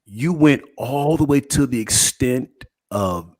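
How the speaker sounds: slow, drawn-out incredulous question. Retold or imitated. Duped buyer after opening the box, incredulous. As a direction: spell out your disbelief slowly, pausing.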